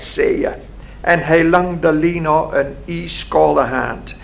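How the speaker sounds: usual speaking voice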